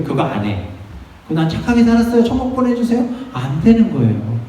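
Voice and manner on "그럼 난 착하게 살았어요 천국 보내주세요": high-pitched mock plea. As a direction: act out someone asking to be let in, pitched up. Acting out a self-justifying person's plea. (높은 목소리로 조르듯)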